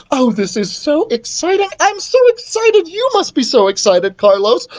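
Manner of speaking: high, breathless-with-joy gushing; excited queen. Acted. Queen gushes to reluctant son, excited.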